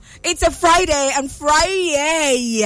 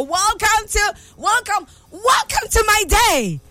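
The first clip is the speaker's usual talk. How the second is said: shouting welcome; 8 semitones higher than usual. Real, not acted. Host shouting welcome on her birthday show. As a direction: call out the welcome with joy. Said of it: "from shouting".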